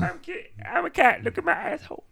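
high squeaky cartoon cat; Kit cat voice. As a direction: play a goofy high-pitched cat. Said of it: "that's my voice for Kit".

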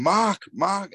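high, calling out a name; a mild mock call from behind the goal; mock shouting kid. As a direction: call out across the stand. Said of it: "this kid was just shouting".

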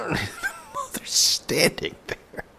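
very high, strained squeak; slow, high voice squeezed by mock effort; abnormal squeezed voice. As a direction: talk as if straining through a sit-up. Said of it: "let's talk in a normal human voice again".

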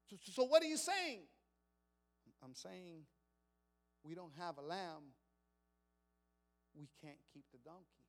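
father telling his family they must lose the donkey; grieving father. Acted. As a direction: break the news you dread. Father confirms the loss.

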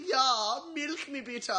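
high mock German cow; German accent. Classmate improvising as a cow, high German accent. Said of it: "anything in a German accent".

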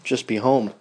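ordinary delivery

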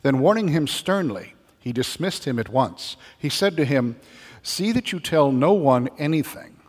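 formal, deliberate proclamation; gospel reading. Acted.

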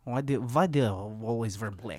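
low, soft mock Russian; understated, put-on Russian voice; Russian accent. Shown as a demonstration. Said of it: "to get into the accent".

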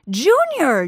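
loud bellow of a name. A narrator's reading. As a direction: bellow it with full force. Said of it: "George bellowed".

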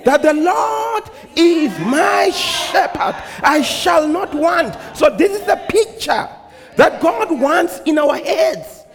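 high, ringing preacher voice; impassioned preaching. Acted. Preacher telling congregation of David and the good shepherd, impassioned preaching.

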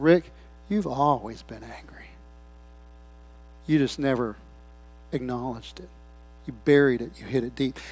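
soft, low, gentle telling; hushed divine voice. Retold or imitated. The Lord speaking to the preacher's heart, hushed.

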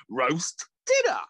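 food guy voice. Retold or imitated. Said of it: "He's like".

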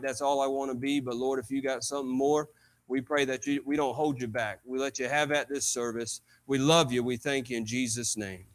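real prayer before preaching, pitched well down. Real, not acted. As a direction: pray humbly in a low, even murmur.

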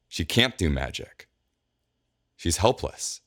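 ordinary delivery, nothing marked

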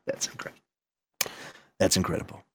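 hushed sarcastic aside; whispered deadpan. Retold or imitated. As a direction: breathe it out flatly.

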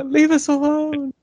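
very high, slow pleading; high quote voice. Retold or imitated. Imitating movie line.

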